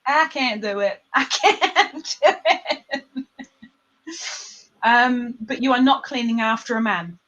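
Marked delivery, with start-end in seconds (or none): 0.1-3.0 giggling while giving up; laughing. Real, not acted. Host failing at a Southern accent, laughing helplessly. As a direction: let the laugh break up the words.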